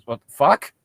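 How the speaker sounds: ordinary talk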